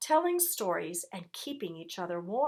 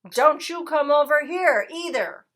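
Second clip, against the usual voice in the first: loud, higher shout back; 7 semitones higher, 9 dB louder than usual. A narrator's reading. Rat yelling back at the dog, shouting. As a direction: yell it right back at him. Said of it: "shouted Rat".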